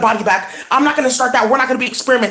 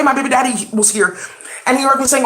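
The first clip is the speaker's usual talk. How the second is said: ordinary talk, nothing marked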